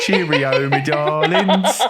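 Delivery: loud, slow, laughing cheerio; hammy British farewell. Pretend. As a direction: ham up a jolly British goodbye.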